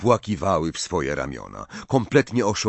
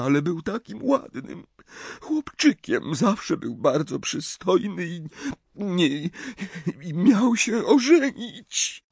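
higher, tearful, broken phrases; sobbing mother; 9 semitones higher than usual. A narrator's reading. Mother sobbing over her scarred son, tearful and high. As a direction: weep over what he has lost. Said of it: "Zaczęła szlochać".

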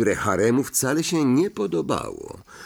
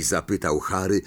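ordinary delivery

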